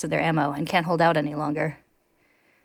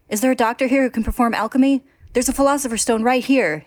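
higher and louder, pleading; desperate shout; 7 semitones higher, 4 dB louder than usual. A narrator's reading. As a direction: shout for help in panic. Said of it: "he shouts".

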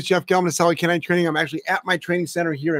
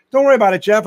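mock reassurance; 5 semitones higher, 5 dB louder, faster than usual. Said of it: "You even said".

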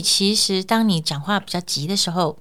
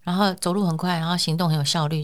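ordinary delivery

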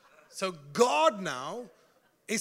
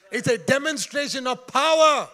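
emphatic preaching; 4 semitones higher, 9 dB louder than usual. Real, not acted.

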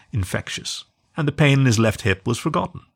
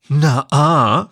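put-on loud childish disbelief; 5 semitones higher, 5 dB louder than usual. A narrator's reading. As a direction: scoff like a doubting kid. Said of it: "said the husky in disbelief".

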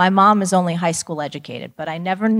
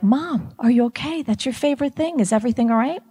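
higher-pitched, lightly acted concern; mild retold worry; 7 semitones higher than usual. Daughter checks on mom over the dismantled radio, mildly worried. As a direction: play a child's anxious questions, lightly.

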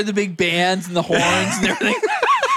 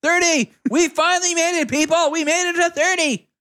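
excited cheer; 8 semitones higher than usual. Real, not acted. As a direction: cheer it to the crowd. Celebrating thirty episodes.

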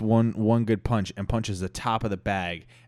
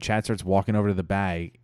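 ordinary delivery, nothing marked

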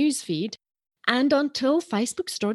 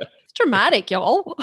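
Southern accent; 2 semitones higher, 4 dB louder than usual. Shown as a demonstration. Showing the host how dramatic it is, Southern accent. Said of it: "the Southern accent".

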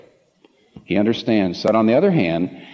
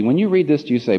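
ordinary talk, nothing marked

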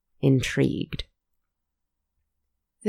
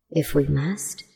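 sadly. A narrator's reading. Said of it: "the gentle one said sadly".

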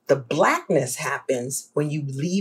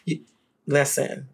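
ordinary talk

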